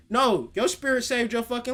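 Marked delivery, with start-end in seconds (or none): none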